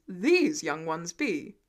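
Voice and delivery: stately booming question; slow grand Thor. A narrator's reading. Thor addressing the class, slow and grand. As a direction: ask it like royalty.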